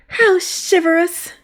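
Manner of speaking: high, slow swooning squeal; breathless high squeal of delight. Acted. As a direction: gush it like a smitten maid. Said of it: "Maria squealed".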